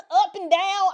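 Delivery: chuckling as she finishes the list; laughing. Real, not acted. Host laughing at her own yin-yang list, laughing.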